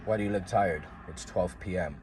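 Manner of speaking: low, soft stern dad voice; father questioning his tired son, low and soft; imitating dad. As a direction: drop low and quiet like a suspicious father.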